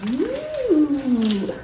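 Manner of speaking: a drawn-out, delighted ooh at the gift; surprised ooh. Real, not acted. Unwrapping her first advent gift for viewers, a delighted ooh. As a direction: let the surprise rise up high.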